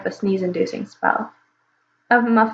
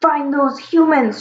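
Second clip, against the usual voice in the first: slow, higher gruff dragon shout; 5 semitones higher, slower than usual. Acted. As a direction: bellow the order to the hunters. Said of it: "shouted a gruff dragon voice".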